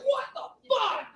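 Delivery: high, squeaky disbelief; mock outrage. Son answering dad's disappointment jab, high mock outrage. Reacts to father's put-down.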